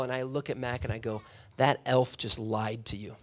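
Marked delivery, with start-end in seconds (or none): 1.6-3.2 put-on low deadpan remark to a child; deadpan serious. Father tells Mac about the elf, deadpan. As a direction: drop the pitch and say it straight. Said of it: "I'm serious".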